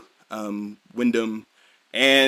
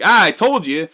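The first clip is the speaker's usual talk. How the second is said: higher, louder, imitating a smug king; gloating; 8 semitones higher, 10 dB louder than usual. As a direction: rub their noses in it. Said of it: "the king basically starts gloating".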